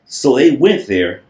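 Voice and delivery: ordinary delivery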